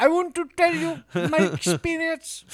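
put-on high, rushed Indian telemarketer accent. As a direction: read it like an Indian telemarketer script. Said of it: "kinda sounding like Indian telemarketer".

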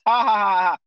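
loud put-on "ha, ha, ha" imitating the crowd; mock laughter. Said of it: "And everybody laughed".

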